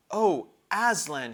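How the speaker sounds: high, loud, slow joyful cry; glad cry. Acted. Edmund seeing Aslan at last, loud joyful cry. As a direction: cry out in joy. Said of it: "he cried".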